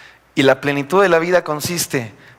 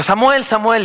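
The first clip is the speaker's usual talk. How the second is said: calling voice; 8 semitones higher than usual. Acted. Preacher voicing God calling young Samuel, calling out. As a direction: call out the name as if from another room.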